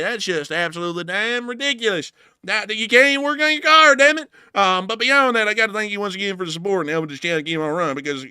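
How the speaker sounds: rambling Southern mechanic voice with exasperated bursts. Acted. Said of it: "thank you for enjoying this accent".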